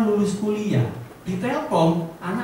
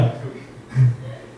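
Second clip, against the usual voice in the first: a short unvoiced laugh at his own slip; breathy laugh; 7 semitones lower than usual. Real, not acted.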